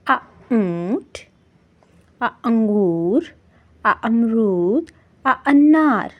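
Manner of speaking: drawn-out अ before each word, stretched slowly; short a sound. Shown as a demonstration. Teacher sounding out अ slowly for children. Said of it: "इसकी इब्तदाई आवाज़ है".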